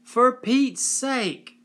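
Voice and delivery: angry yell. A narrator's reading. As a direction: yell it in exasperation. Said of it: "she yelled at them".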